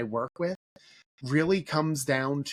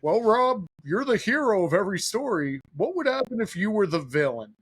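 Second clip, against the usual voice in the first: stoic buddy's baritone; 5 semitones higher than usual. Retold or imitated. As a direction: put on a big buddy's voice to challenge someone. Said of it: "he has a big baritone voice".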